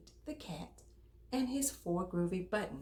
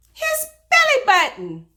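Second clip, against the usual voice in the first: high, loud joyful punchline; spring the surprise on the kids; delighted reveal; 18 dB louder than usual. Acted. Storyteller revealing the twist to children, loud delighted exclaim.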